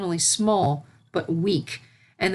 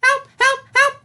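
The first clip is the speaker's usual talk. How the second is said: panicked cries; 7 dB louder than usual. A narrator's reading. Filly sinking in the pool.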